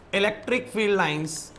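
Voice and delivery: very slow, long pauses between phrases; slow dictation. Shown as a demonstration. Teacher dictating the first point to students, very slow. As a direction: dictate each chunk so the class can write. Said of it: "मैं अब पहले point पे पहुंचने वाला हूं".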